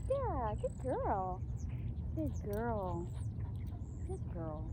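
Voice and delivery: slow, soft praise for an excited dog; calm her down with gentle praise; calm low-energy praise. Real, not acted. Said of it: "very low energy for me".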